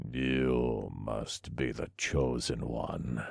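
slow, soft, low and grisly; a chained villain's low, slow growl. A narrator's reading. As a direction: drop low and draw it out, grisly. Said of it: "said in a low, grisly voice".

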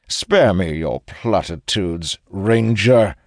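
curt, biting retort; sharp. A narrator's reading. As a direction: snap back with contempt. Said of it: "Reloz says sharply".

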